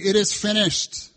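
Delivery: a triumphant cry; slow, loud cry of triumph. A narrator's reading. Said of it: "With a loud cry".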